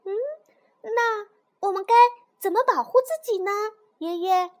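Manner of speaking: little toad, high and eager question; kleine Kröte. A narrator's reading.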